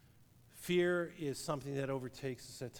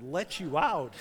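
incredulous; 2 semitones higher, 8 dB louder, slower than usual. Retold or imitated. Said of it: "I said".

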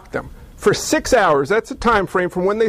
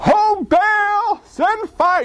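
much higher, wailing plea to Baal; frantic cry; 11 semitones higher, much slower than usual. Retold or imitated. A prophet of Baal screaming for fire from heaven. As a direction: shriek it like a crazed worshipper. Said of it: "they started calling out".

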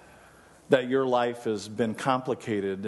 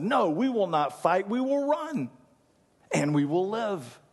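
higher, pleading refusal to fight; fearful soldier refusing; 9 semitones higher than usual. Acted. Frightened soldier answering Wallace, higher and pleading. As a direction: back out of the battle, voice rising. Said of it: "one man steps forward and said".

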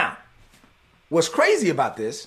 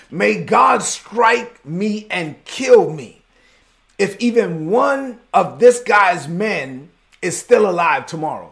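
furious vow aloud; 2 semitones higher, 5 dB louder, slower than usual. Retold or imitated. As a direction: declaim a vengeful vow, word by word. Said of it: "David said this out loud".

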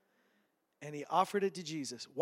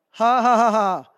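loud, scornful ha ha ha; mock derisive laughter, much louder; 4 semitones higher, 13 dB louder than usual. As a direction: laugh the boy's basket off. Jesus mocking the boy's gift.

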